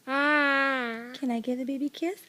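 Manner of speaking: drawn out and slow; slow coaxing. Shown as a demonstration. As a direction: ask it slowly, coaxing. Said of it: "Let's see if I can do it".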